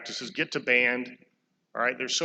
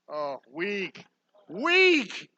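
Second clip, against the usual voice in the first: mock disappointed; 3 semitones higher, much slower than usual. Real, not acted. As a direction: groan at the crowd, then jeer. Mocking the freshmen's weak shout.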